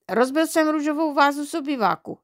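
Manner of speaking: high-pitched blurt; blurted confession. A narrator's reading. Boy confessing the broken vase to dad, blurting. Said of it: "Vychrlil jsem ze sebe blesku rychle".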